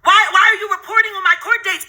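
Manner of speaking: ordinary delivery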